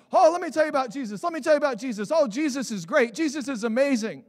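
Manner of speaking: mock over-eager teenage enthusiasm; eager new convert. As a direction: imitate his teen self raving about Jesus. Retelling his teenage new-convert zeal.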